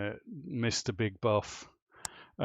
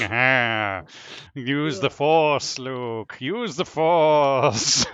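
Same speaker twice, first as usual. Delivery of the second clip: put-on slow, drawn-out wise old mentor; Obi-Wan Kenobi; 7 dB louder, much slower than usual. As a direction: stretch out each word like a sage Jedi. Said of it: "looked like bloody Obi-Wan Kenobi".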